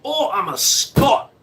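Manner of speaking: high, drawn-out mock Scottish; Scottish accent. Shown as a demonstration. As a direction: parody your own bad Scot. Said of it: "sit there and go".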